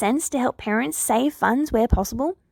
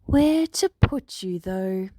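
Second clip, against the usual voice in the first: musing Nedzu; slower than usual. A narrator's reading. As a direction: think aloud slowly as the principal. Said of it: "he muses".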